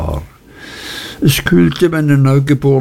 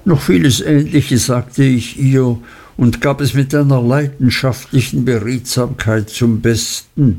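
ordinary delivery

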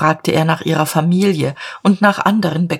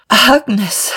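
Agnes called out louder and higher on a long exhale; long relieved sigh; 5 semitones higher, 3 dB louder than usual. A narrator's reading. Diederich alone in his dark room, a loud relieved sigh. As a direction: breathe her name out in release, raised. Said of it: "sagte in einem langen Aufatmen".

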